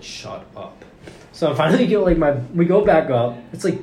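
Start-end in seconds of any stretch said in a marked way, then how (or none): 0.0-0.8 breathy muttered shut up, under the breath; whispered shut up. Retold or imitated. To the technician, a secret exasperated whisper. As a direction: say it to yourself, barely voiced. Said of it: "I'm like".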